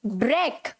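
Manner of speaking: ब्रेक as short low "brek"; correct pronunciation. Shown as a demonstration. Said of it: "तो कैसे बोलेंगे इसे?".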